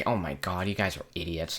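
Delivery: low, soft, fed-up teacher; teacher scolding kids, low and soft; weary teacher. Retold or imitated. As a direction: sigh it out like a tired teacher. Teacher voiced.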